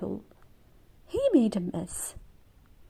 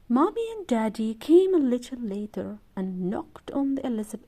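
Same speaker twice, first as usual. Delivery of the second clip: ordinary delivery, nothing marked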